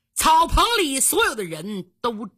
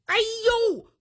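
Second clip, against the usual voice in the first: "aiyou" cried out high-pitched; acted cry of someone hit; high yelp of pain; 12 semitones higher than usual. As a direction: yelp as if suddenly shot. Imitated cry of man shot.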